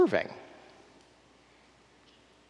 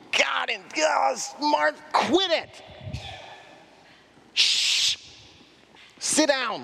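higher, sharp mock rebuke with a hush; mock exasperated scolding; 8 semitones higher, slower than usual. A pretend Jesus scolding Martha, mock exasperated. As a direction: snap at her in pretend exasperation. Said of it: "pretend you're Jesus ... yelling".

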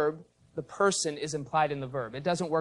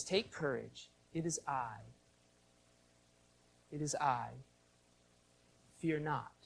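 soft, hushed, with long pauses; calming reassurance; 3 semitones lower, 12 dB quieter than usual. Retold or imitated. Jesus to frightened disciples from the water, soft and calming. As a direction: quietly settle their fear. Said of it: "to calm their fears".

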